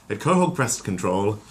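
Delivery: plain reading voice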